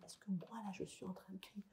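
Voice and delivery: the faintest calming whisper. Shown as a demonstration. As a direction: fade almost to nothing to calm the child. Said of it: "de pouvoir descendre ma voix".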